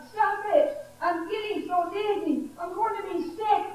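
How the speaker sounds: high-pitched, dizzy protest; mild mock scream. A narrator's reading. Clay spun on the wheel begging to stop, mock screaming. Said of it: "I screamed".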